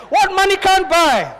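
very high, loud, drawn-out preacher's cry. Shown as a demonstration. Preacher showing congregation how to say it, loud high preaching. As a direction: belt it out slowly to the congregation. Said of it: "say it like a preacher".

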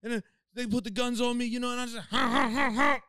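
high, thin mocking voice; gangster guest impression. Retelling the podcast guest's story in a high mock voice. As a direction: play the gang member high and cartoonish. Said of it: "He's like".

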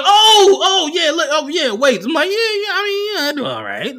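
high, loud laughing fluster; laughing through words. Retold or imitated. Comedian retelling how he laughed answering the driver, high and loud. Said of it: "And I'm laughing like".

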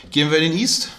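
ordinary talk

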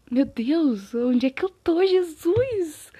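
loud, rising exclamation; mock panic. Vlogger reliving waking confused after the fall, mock panic. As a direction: exclaim in mock alarm, climbing high at the end.

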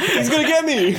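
high, loud mock child squeal; panicked kid. Kids fleeing a real Australian, high mock-scared voice. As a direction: play frightened kid, laughing. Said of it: "they're gonna be like".

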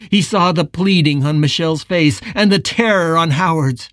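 usual speaking voice

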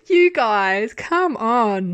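loud, exasperated pleading; exasperated appeal. Retold or imitated. Viewer to the indiscreet couple, exasperated. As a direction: call them out in comic disbelief.

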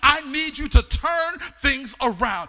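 loud pleading prayer; preacher shouting a bold prayer; fervent prayer. Acted. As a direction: cry out to God with force.

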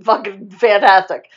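loud mock-enthusiastic outburst; sarcastic exasperation. Characters react to the kissing deadline, loud and sarcastic.

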